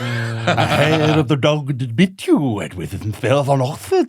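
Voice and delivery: slow, theatrical wizard shopkeeper; slow hammed-up wizard welcoming you to his shop; annoying wizard voice. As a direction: roll it out like a fairground mystic. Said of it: "an incredibly annoying wizard voice".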